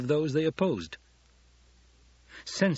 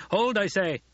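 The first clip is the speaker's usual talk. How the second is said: high, raised, insistent order; commanding call; 9 semitones higher, 3 dB louder than usual. A narrator's reading. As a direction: call a halt with authority. Duke orders fighters to stop.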